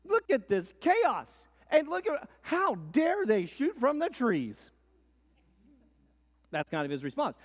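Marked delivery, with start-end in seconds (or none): 0.0-4.7 slower, louder indignant demands; a general scolding men who break ranks; mock fury, higher and louder; outraged general. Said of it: "General Braddock rides up and says".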